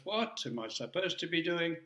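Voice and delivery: ordinary talk, nothing marked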